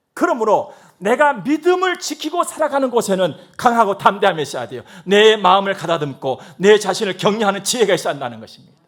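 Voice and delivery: loud, driving pulpit voice; forceful sermon delivery; full-force preaching. Acted. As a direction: preach it hard to the congregation.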